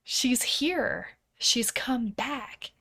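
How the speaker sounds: put-on little girl's hushed, high-pitched announcement. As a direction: imitate a little girl in a high near-whisper. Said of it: "one of the little girls say".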